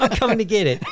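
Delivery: high, laughing fake menace; mock threat. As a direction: threaten jokingly while cracking up.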